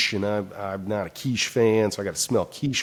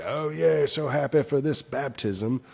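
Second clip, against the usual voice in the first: sarcastic fake joy; 6 semitones higher than usual. Sarcastic a-hole at baptism.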